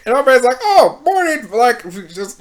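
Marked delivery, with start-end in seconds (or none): 0.6-1.5 high, chirpy mock-casual greeting. Man caught by the shocked girl, breezily casual. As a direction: toss it out loud and breezy, as if nothing's happening. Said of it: "like, casually".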